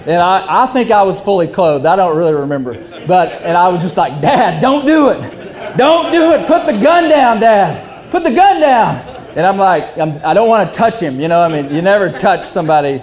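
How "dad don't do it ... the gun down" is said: pleading negotiator. Retold or imitated. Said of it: "like an F B I hostage negotiator".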